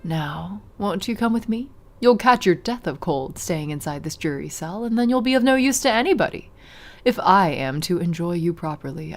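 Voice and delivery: a hint of a cold vampire hostess, unhurried; Magdalena the vampire. Vampire to her shaking captive, mild mocking menace. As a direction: toy with the prisoner, understated menace.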